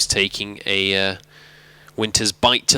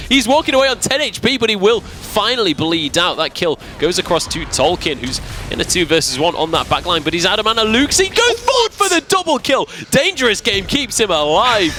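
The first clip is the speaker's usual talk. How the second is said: excited play-by-play; 9 semitones higher than usual. Acted. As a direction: call the teamfight as it explodes. Teamfight play-by-play.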